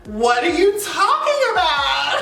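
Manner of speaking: slow, high, nervous giggling question; nervous laughing. Retold or imitated. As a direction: imitate her anxious laugh. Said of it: "this is exactly how she said".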